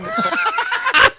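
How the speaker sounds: slow shouted question through laughter; laughing shout. Real, not acted. Host loudly pressing Alex, laughing and shouting.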